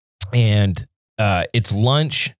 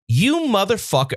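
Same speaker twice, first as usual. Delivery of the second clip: retold rant; 9 semitones higher than usual. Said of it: "I went off on this motherfucker".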